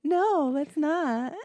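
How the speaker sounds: high, slow drawn-out no; flat refusal. Retold or imitated. Mom refuses the family's new pet, slow and high. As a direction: stretch the refusal out slowly.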